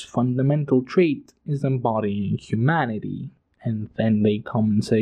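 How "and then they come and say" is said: ordinary talk, nothing marked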